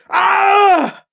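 high, loud acted scream; pained scream. A narrator's reading. Hero as his leg is pulled free, pained scream. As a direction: scream out in agony. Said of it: "Alibi screamed".

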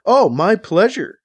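slow, high, loud mock politeness; sarcastic. A narrator's reading. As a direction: drag it out with sarcastic sweetness. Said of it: "matching his sarcasm".

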